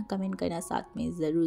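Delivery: ordinary delivery, nothing marked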